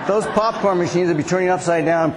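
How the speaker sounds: ordinary talk, nothing marked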